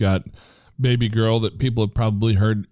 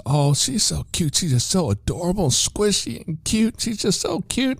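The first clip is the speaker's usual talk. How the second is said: gushing attendant; 11 semitones higher than usual. Retold or imitated. Gas station attendant cooing over the baby girl. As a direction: coo it like a doting stranger.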